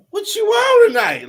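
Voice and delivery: put-on high, slow, sing-song girl voice; high girly voice. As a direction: voice her high and drawn out.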